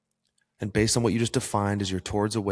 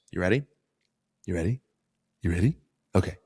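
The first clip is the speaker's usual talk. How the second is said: teasing dog-owner patter with rising questions; lightly playful dog talk; faster than usual. Pretend. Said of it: "Just talking to you like a dog".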